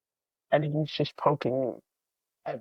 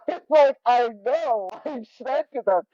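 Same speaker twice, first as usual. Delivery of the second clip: laughing; 9 semitones higher, 5 dB louder than usual. Retold or imitated. Laughing retelling of own reply.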